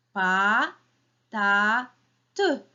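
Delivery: "Pa, ta, te" as three separate beats, first two drawn out and rising; split into syllables. Shown as a demonstration. Teacher counts with children, syllable by syllable. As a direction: chop "Pa, ta, te" apart with a pause after each. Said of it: "comptez avec moi".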